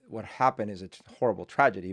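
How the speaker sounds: ordinary delivery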